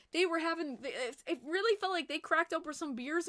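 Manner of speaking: ordinary talk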